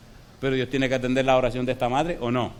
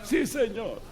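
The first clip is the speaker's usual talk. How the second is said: meek reply; 7 semitones higher, 4 dB quieter, much slower than usual. Retold or imitated. Dying man accepts, weak voice.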